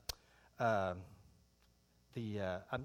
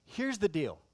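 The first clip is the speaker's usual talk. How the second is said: ordinary talk, nothing marked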